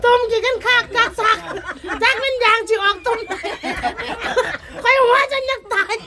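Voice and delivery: talking through real laughter; words bubbling with giggles; laughing too hard to finish. Real, not acted. Laughing at funny phone photos.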